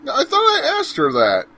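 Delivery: high, loud, slow exasperated complaint. Real, not acted. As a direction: whine it up high in frustration. Annoyed at game choice.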